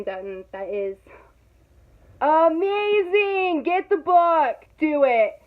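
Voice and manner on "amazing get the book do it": high, loud and slow on amazing; gushing hype. Real, not acted. Vlogger to viewers, gushing loudly about the book. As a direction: sell it like your favourite thing ever.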